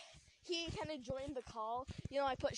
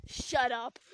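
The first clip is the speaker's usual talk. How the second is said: louder, cold curt shut up; passive aggressive; 9 dB louder than usual. Retold or imitated. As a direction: snap it short, loud and cold. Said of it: "in that exact tone".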